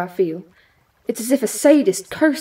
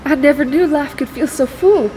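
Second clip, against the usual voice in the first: awed delight; 4 semitones higher than usual. Acted. Rogue marvelling at a goddess's power, excited. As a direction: burst out in amazement.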